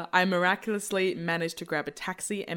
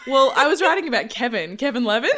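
higher, louder words through laughter; laughing; 12 semitones higher, 10 dB louder than usual. Real, not acted. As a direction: confess it while cracking up. Laughing at own confession.

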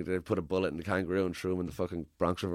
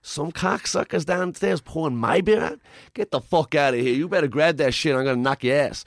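mock enraged mobster; 5 semitones higher, 6 dB louder than usual. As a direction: rage like a hammed-up wiseguy. Alfie raging at the news.

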